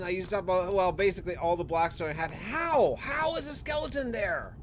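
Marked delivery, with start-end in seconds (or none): 2.3-4.6 real disbelief at a mob that shouldn't exist; baffled surprise. Real, not acted. Spotting a skeleton in his finished tunnel, higher, baffled surprise. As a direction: pitch jumps up, baffled and puzzled. Skeleton spawns on spawn-proof ice.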